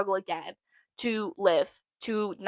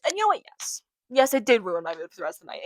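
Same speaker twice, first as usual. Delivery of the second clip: ordinary delivery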